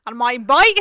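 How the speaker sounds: explosively loud mock sign-off. Co-host breaks into partner's serious ending, hugely loud. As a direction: blast it out, clipping the mic. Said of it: "you're so loud".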